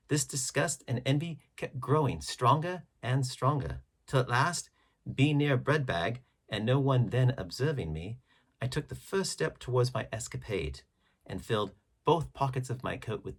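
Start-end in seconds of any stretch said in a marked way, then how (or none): none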